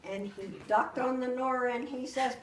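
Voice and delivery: usual speaking voice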